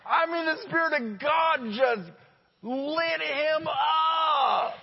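high, loud laughing shout; excited shout. Real, not acted. Preacher telling the congregation, a joyful laughing shout. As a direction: shout it out with joy, stretching the end. Preacher recalls airplane prayer moment.

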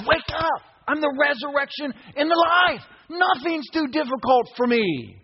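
high shouted proclamation, slowing at the end; commanding shout. Acted. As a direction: proclaim it like a voice from heaven. Preacher voicing Jesus shouting.

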